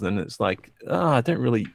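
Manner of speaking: ordinary delivery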